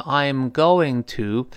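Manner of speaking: slow, full "I am going to"; slow reading. Shown as a demonstration. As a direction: say "I am going to" slowly, unreduced. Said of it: "慢速跟读的时候呢，".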